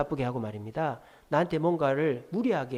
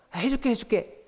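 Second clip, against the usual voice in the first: higher-pitched, repeated yielding; giving in; 7 semitones higher than usual. Acted. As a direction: relent to a nagging child. Parent giving in, acted out.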